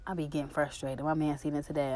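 ordinary delivery

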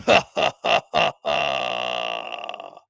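low, hammed-up villain laugh; long supervillain "ha-ha-ha", last one drawn out; mock evil laugh in separate bursts. Villain laugh after voiced threat.

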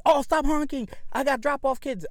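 imitated high, loud scolding; retold yelling. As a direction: yell like the annoyed bus driver. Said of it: "yelling at me".

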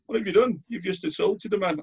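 high, startled officer's voice; playing the shocked police officer. PCSO telling off the speaker, high startled voice. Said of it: "a P C S O that was there going".

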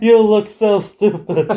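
slow, high, laughing taunt; mock him through laughter; laughing mockery. Host laughing at Kurt Angle's crown and cape.

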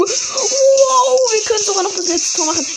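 high, fast, thrilled shriek; shrieking jackpot winner. Real, not acted. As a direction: squeal like you just won big. Sudden chance at last goal.

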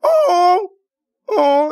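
very high squeaky whimper; playful near-crying. Pretend. Host reacting to a sad dating story, squealed mock crying. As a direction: wail it, then coo in hammed-up pity. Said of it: "I'm about to cry for real".

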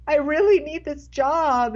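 high, slow retold whine; mock crying plea. Fired waitress begs boss for her job, mock-tearful plea. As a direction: plead as if tearful, lightly acted. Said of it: "And I was crying".

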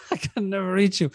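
breaking into a laugh mid-quote. Real, not acted. Man spots a tissue up his listener's nose, laughing. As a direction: try to quote it through a laugh.

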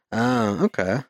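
ordinary talk, nothing marked

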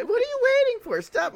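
high, loud mock-hysterical yell; screaming. Echoing co-host's screaming heroine, high loud yell. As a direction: shriek it in a high voice.

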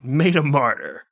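slow solemn anchor read; news anchor voice. Acted. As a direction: relish the name like a newsreader. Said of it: "This is a fun word to say".

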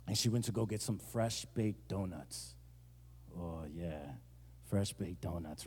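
low, very soft, savoring hush; hushed reverence. Pretend. Pastor savoring fresh baked donuts, hushed reverence. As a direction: drop to a low, dreamy near-murmur.

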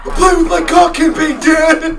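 loud joking shout. Acted. Joking as son to dad.

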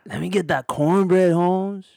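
high, loud mock Spanish accent; hammed-up Spanish-accented customer order; put-on Spanish accent. Role-play after switching accent roles.

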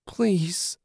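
high, pleading appeal; begging. A narrator's reading. Julie reaches out for rescue, begging. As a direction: beg in a high voice, without volume. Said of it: "she begged".

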